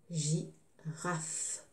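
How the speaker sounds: soft, low GI, long pause, RAFE; teacher softly sounding out "Girafe" in pieces; syllable by syllable. Shown as a demonstration. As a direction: split "Girafe" into two quiet, separate beats. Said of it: "je découpe le mot".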